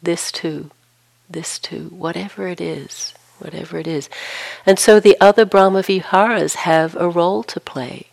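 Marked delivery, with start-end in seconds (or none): 0.0-4.1 softly repeated, fading to very quiet with pauses between; gentle acceptance. Acted. Meditation teacher voices open arms holding complexity, softly accepting. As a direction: let each phrase settle more softly, as if welcoming whatever comes. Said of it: "open arms that just says".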